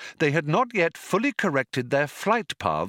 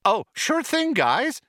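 very high, breezy computer reply; chirpy computer; 12 semitones higher than usual. A narrator's reading. Computer obliging the panicking crew, chirpy and high.

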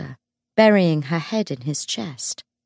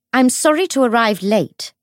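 raised, louder public voice; practiced projection; 6 semitones higher, 6 dB louder than usual. A narrator's reading. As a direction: pitch it to carry over a crowded room. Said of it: "in the practiced tone of someone used to pitching her voice loudly".

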